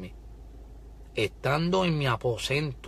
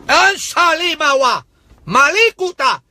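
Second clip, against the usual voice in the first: high, loud ecstatic tongues; preacher erupting into tongues, high and loud; speaking in tongues; 14 semitones higher, 8 dB louder, slower than usual. Real, not acted. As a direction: burst into tongues in the spirit. Speaking in tongues mid-sermon.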